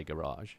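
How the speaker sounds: "garage" stressed on the second syllable, ending in a soft zh; posher English garage. Shown as a demonstration. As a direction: say "garage" as guh-RAHZH. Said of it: "posher English people say would".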